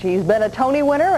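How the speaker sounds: normal talking voice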